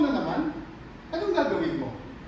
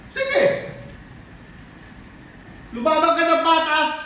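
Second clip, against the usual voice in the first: very high opening cry, then raised voice; shouted challenge; 4 semitones higher, slower than usual. Acted. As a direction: dare your rival to fight, shouting. Acted challenge to fight fair. (pasigaw na paghamon)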